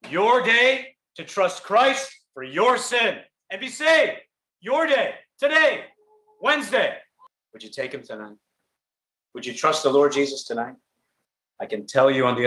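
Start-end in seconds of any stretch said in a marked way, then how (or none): 0.1-7.0 rising fervent appeal. Acted. Preacher's climactic appeal.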